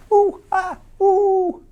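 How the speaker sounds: very high ooh-ah monkey calls; monkey hoots. Acted. Ending the bedtime book, high monkey hoots. Monkeys call at story end.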